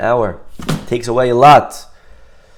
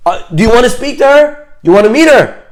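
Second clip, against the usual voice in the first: high, loud, excited begging; acted eager pleading; 10 semitones higher, 6 dB louder, faster than usual. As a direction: play a mother urgently pressing for a favor. Excited pleading for a meeting retold.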